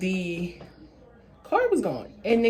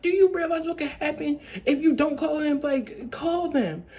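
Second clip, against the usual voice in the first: higher-pitched pleading insistence; exasperated urging; 6 semitones higher than usual. Retold or imitated. Urging a friend to call the grandparents, exasperated. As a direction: retell pressing someone to see sense.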